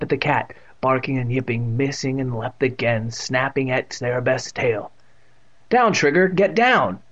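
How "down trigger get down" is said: higher urgent command to a dog; urgent cry. A narrator's reading. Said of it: "Evan cried".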